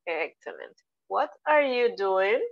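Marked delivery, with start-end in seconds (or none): 1.1-2.4 slow, careful "What are you doing?" with rising end; teacher's slow model question; slow model sentence. Shown as a demonstration. Teacher models the target sentence slowly.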